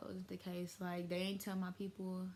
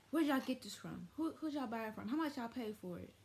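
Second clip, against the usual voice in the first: higher-pitched mock grilling; imitated interrogation; 5 semitones higher than usual. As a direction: act out a relative firing off questions. Relative interrogates them in retold scene.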